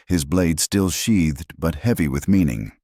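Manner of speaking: plain reading voice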